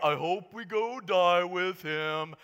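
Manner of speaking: slow, mopey Eeyore-style moan; gloomy Eeyore. Pretend. Thomas grumbling to the disciples, mopey like Eeyore. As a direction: drag it out like Eeyore. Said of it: "just Eeyore over here".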